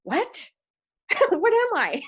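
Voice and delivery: high, laughing, bewildered questions; retold disbelief. Retelling her childhood shock to the group, high and laughing. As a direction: ask in mock shock, half laughing. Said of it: "retold childhood reaction".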